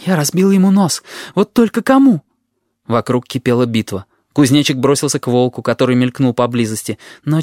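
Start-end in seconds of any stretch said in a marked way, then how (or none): 0.0-2.2 high-pitched excited outburst; worked-up, higher voice; agitated. A narrator's reading. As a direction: blurt it out mid-fight, pitch climbing. Fighter's agitated thought mid-brawl.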